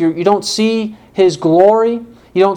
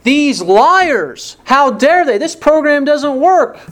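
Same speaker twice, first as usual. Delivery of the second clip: higher, mock outraged woman; hammed-up angry customer; indignant outburst; 9 semitones higher, 3 dB louder, slower than usual. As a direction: act out her fury at the diet company. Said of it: "And she gets upset".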